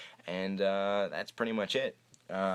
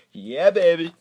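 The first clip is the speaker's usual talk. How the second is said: excited cheer; 8 semitones higher, 9 dB louder than usual. Real, not acted. Cheering his team's new quarterback.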